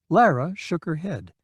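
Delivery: usual speaking voice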